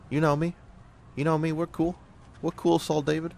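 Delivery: high-pitched put-on buddy voice; mock-pleading high voice to a pal; pally reassurance. Reassures his soldier in character.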